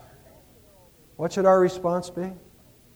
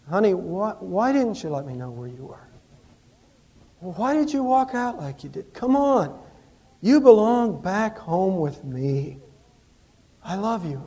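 pleading husband; 3 semitones higher than usual. Retold or imitated. Husband pleading with runaway wife.

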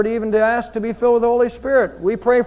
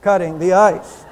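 intercom voice; 2 semitones lower than usual. Retold or imitated. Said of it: "the voice over the intercom said".

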